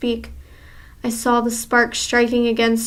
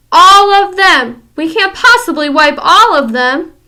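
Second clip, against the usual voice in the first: much higher, loud, aghast outcry; alarmed witch; 6 semitones higher, 11 dB louder than usual. Acted. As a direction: cry out in dismay. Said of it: "one witch ... saying aloud".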